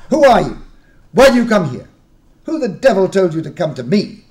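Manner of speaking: loud, high hostile questioning; fierce interrogation. Acted. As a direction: interrogate them, furious and suspicious. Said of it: "began the man".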